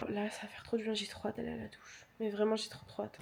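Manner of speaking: soft whisper, barely voiced. Real, not acted. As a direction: hush it to the camera. Talking low, boyfriend in the bathroom.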